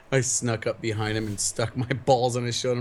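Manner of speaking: normal talking voice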